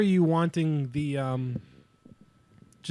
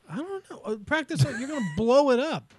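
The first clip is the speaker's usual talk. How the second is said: mock buyer voice; 9 semitones higher than usual. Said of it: "goes like".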